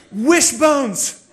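loud, high burst of delight; excited child's answer. Retold or imitated. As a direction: mimic a kid shouting out the treat. Imitates excited daughter naming restaurant.

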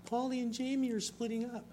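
high, disbelieving wail of dismay; mock-distraught fan voice; dismayed disbelief. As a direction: act out the shock of a fan whose show couple splits. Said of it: "And I was like".

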